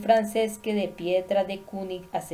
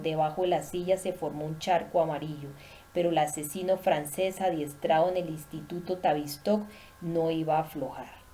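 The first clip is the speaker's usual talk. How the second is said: plain reading voice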